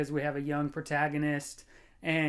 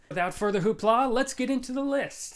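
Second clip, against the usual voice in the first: higher, louder, fast hype; excited host; 8 semitones higher, 5 dB louder than usual. Real, not acted. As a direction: kick off the list like a host. Hyped segue into the ranking.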